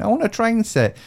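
wife's plea, pitched well up; imitating wife. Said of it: "my wife, she started saying".

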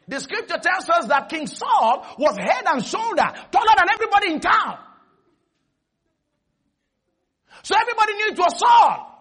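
high-pitched preacher's proclamation; full-force preaching. Real, not acted. Preacher telling the congregation how tall King Saul was, high forceful preaching. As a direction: build to a ringing, high shout.

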